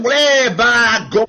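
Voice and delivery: hammed-up Yorkshire exclamation; Yorkshire accent. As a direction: show off a broad Yorkshire accent, slowly. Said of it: "what's your Yorkshire accent like".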